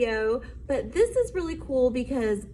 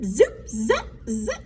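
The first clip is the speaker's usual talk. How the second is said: usual speaking voice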